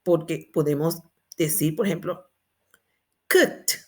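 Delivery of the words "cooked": COOKED as one syllable, "kukt"; pronounced with final T. Shown as a demonstration. Teacher showing students the T ending, pronounced with final T. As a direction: end COOKED on a crisp T. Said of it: "con la T".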